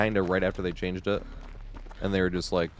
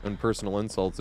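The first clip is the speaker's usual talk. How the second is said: usual speaking voice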